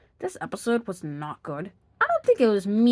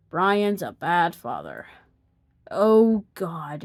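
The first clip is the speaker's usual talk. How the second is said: usual speaking voice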